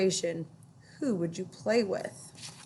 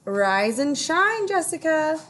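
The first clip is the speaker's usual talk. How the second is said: higher, slow sing-song wake-up; Ruthie's voice; 7 semitones higher, 4 dB louder than usual. A narrator's reading. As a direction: draw out a cheerful morning call. Ruthie waking her friend.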